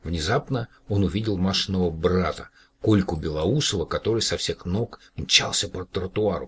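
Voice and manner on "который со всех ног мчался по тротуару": ordinary delivery, nothing marked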